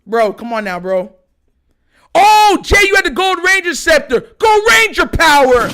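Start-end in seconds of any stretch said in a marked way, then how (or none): 2.2-4.2 loud, high burst of recognition; real fan shout, thrilled by a memory; excited outburst. Real, not acted. Fan remembering Jay's scepter, excited shout. Sudden delighted recall of a toy. 4.4-5.7 loud, raised morphing call; hero battle cry. Retold or imitated. Fan imitating Gold Ranger, heroic shout.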